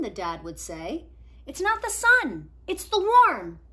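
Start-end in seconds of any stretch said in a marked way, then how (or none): 1.5-3.6 put-on higher, louder eager boy; Nico explaining. Nico explaining his drawing to friends, eager and louder. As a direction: insist patiently.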